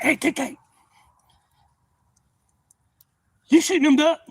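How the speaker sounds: shouting across the creek; high calling out. Retold or imitated. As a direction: call out loud and high.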